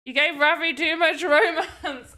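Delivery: laughter breaking into the words; amused laughing. Real, not acted. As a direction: tease through a real laugh. Laughing while teasing about the game.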